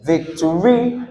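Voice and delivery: "Victory" as vic-to-REE, rising onto REE; end-stressed "Victory"; stress on last syllable. Shown as a demonstration. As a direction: push the final syllable of "Victory" up. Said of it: "Let's stress this one".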